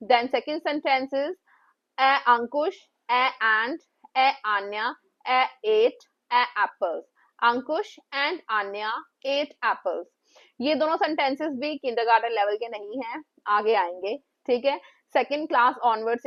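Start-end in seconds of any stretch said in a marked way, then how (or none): none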